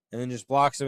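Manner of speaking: usual speaking voice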